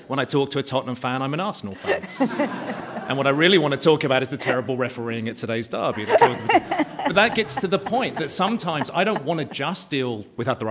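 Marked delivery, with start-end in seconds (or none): 1.2-2.1 laughing. Real, not acted. Panelist jokes about Tottenham fans, laughing. Punchline about football rivalry. 2.1-3.0 quick airy laugh at his own joke; high breathless laugh. Real, not acted. Panelist laughs at his own Arsenal joke, airy laugh. 3.1-10.7 talking through laughter, a bit louder; laughing. Real, not acted. Panelist jokes about derby refereeing, laughing through words.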